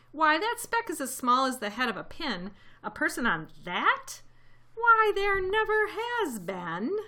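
sour kangaroo. A narrator's reading. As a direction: scoff in disbelief, stretching out the end. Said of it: "'Twas a sour kangaroo".

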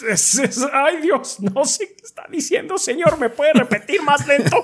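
mock flustered plea. Mock confusion at Scottish speaker.

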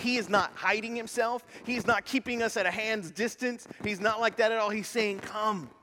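high, loud sermon delivery; impassioned preaching. Real, not acted. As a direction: preach it out with full force. Preaches at raised intensity.

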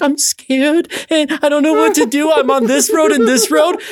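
high, slow, whimpering plea; whiny scared. Retold or imitated. Caller whining to the 911 operator, high and slow. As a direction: play it small and helpless. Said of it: "I was a little bitch".

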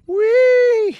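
very high, loud "wee"; gleeful loud squeal; slide whoop. Acted. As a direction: let it fly out of you on the drop. Dad slides with the diamond.

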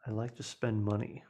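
hushed, breathy delivery; whisper yelling. Pretend. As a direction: keep it low like a secret. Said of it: "Why are we whispering?".

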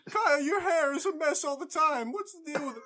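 high screechy Seinfeld bit; Jerry Seinfeld impression. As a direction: yell it like Seinfeld. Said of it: "give me some Jerry Seinfeld".

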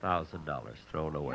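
sad moan. Acted. Moaning in bed over money.

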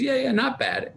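high, offhand mom voice; imitating mom. Mom brushing off son's poem, high offhand voice. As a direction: toss it off like his unimpressed mom.

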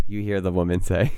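normal talking voice

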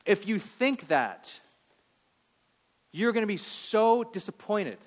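loud, rising pulpit fervour; fervent preaching. Acted. Pastor pressing his congregation, in loud preaching fervour. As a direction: drive it home to the congregation.

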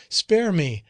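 high, loud desperate begging; desperate plea. Acted. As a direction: plead for your life. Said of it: "she exclaimed".

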